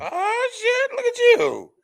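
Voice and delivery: very high-pitched delighted squeal; high, playful "look at you" praise; impressed teasing. Real, not acted. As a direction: coo with exaggerated pride. Teasing praise for starting college.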